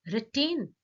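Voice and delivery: "retain" high and loud, R before "tain"; R first, then tain. Shown as a demonstration. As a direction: lift "retain" as a sample word, opening on R. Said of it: "pronouncing R first and then comes tain".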